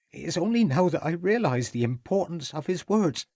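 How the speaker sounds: tearful, building. A narrator's reading. Cook fearing for the young master, rising tearful and slowing. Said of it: "giving a loud sob".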